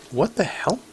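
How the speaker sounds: usual speaking voice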